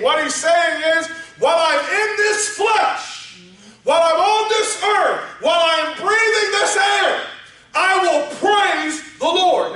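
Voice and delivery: preaching that climbs to a high shouted climax; building from preaching to full shout. Acted. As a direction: build and build until you shout the last words. Preaching crescendo on praise.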